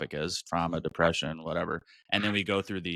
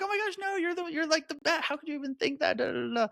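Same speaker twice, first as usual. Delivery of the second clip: much higher, fast, gushing reassurance to a best friend; faster than usual. Retold or imitated. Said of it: "I'm like".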